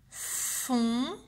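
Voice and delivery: "fontaine" split into three long syllables, fon-tai-ne. Shown as a demonstration. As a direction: dictate "fontaine" very slowly, holding each sound. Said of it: "écrire la première syllabe". (« fontaine » dicté lentement en trois syllabes séparées)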